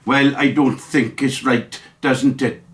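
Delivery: slow, lightly put-on Welsh accent; mock Welsh accent. Mocking the Welsh leader, slow Welsh accent. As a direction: send up the Welsh leader in a Welsh lilt. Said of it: "that Welshman who speaks like this".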